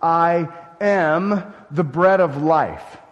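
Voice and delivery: each word weighed out slowly and firmly; slow emphatic declaration. Retold or imitated. Jesus proclaiming who he is, slow and emphatic. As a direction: lay down every word like a solemn claim. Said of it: "where he said emphatically".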